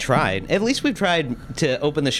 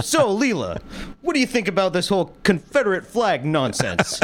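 interviewer voice; 7 semitones higher than usual. Retold or imitated. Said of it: "You just turn to her".

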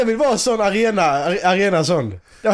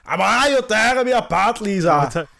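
hammed-up action-star accent on a melodramatic plea; Arnold Schwarzenegger; 3 semitones higher, slower than usual. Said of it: "hade varit Arnold Schwarzenegger som var Tommy Wiseau".

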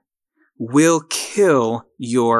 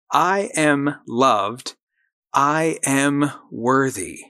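slow, deliberate, every word weighted; slow affirmation; much slower than usual. Shown as a demonstration. Host leading listeners in an affirmation, slow and deliberate. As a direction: lay it down slowly so they can repeat it. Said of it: "you gotta say it with me".

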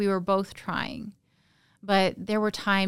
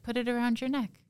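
a firmer, higher retelling of the order; retold repeated order; 5 semitones higher, faster than usual. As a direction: repeat the command higher, at normal loudness.

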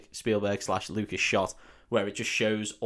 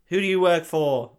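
mock interrogation; 7 semitones higher, 7 dB louder than usual. Indy interrogating the Fez man.

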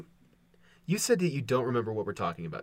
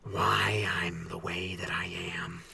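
Joker whisper; 7 semitones lower, 3 dB quieter, slower than usual. Acted. Joker origin voice.

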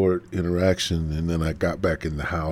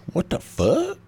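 startled; 12 semitones higher than usual. Retold or imitated. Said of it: "So I'm like".

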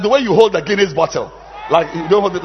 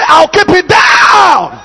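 slow, higher shouted chant; 11 semitones higher, 10 dB louder, much slower than usual. Shown as a demonstration. Preacher leads congregation in slow shouted chant. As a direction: drag it out like a rallying cry.

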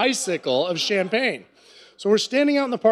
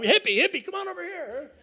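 calling out; 10 semitones higher, 4 dB quieter than usual. Retold or imitated. Calling a hippie over in parking lot.

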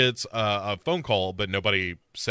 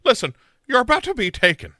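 high, loud, fast mock threat; female Liam Neeson; 12 semitones higher, 6 dB louder, faster than usual. Warning the prank caller, high mock action-hero threat. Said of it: "You are doing the female Liam Neeson".

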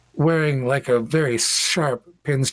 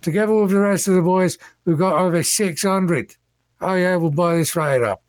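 put-on higher, quick chatter of one of the boys; guy smoking with the boys; 7 semitones higher, faster than usual. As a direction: play a punter boasting to his mates. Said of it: "a few guys standing there smoking and talking".

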